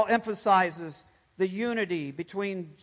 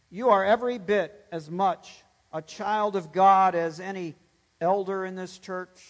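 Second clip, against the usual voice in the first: ordinary delivery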